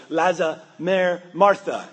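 put-on a dad calling his kids, mixing up names; father fumbling names. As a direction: call out, tripping over the kids' names. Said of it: "her father would say".

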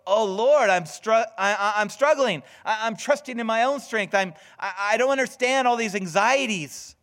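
struggling prayer. Pretend. Believer in crisis crying out to the Lord, loud and stammering. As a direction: cry out to God, tripping over your words. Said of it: "So when you go".

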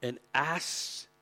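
usual speaking voice

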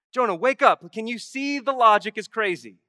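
loud, high wake-up call to Jonah; urgent call. Acted. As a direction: shout him awake. God calling out to Jonah.

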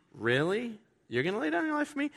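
high, fast, mocking disbelief; incredulous teasing from a friend who doesn't buy it; sarcastic "really". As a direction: scoff at the boast in a high mock voice. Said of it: "It's like people say today".

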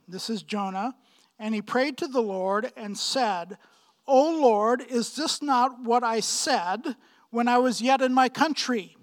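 ordinary talk, nothing marked

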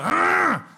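loud high snorting roar imitating a snore; loud imitation of a snoring man; mock snore growl. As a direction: blast out a fake snore. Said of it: "you lay there and you go".

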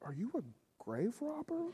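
high, incredulous squeak of disbelief; mock shocked. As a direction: son teasing his mom for stealing from the coffin, high and incredulous. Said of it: "And I was like".

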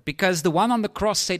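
usual speaking voice

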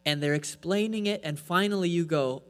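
ordinary talk

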